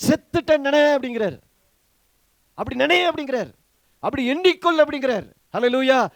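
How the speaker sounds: full-force preaching. Real, not acted. Preacher urges believers to count themselves dead to sin, loud and insistent. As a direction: urge the congregation with full voice.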